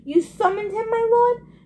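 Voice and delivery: high, rising, anxious question; suddenly tense. A narrator's reading. Invisible servant questions its master, suddenly tense. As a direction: servant alarmed, voice climbing to the end. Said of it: "suddenly sounded tense".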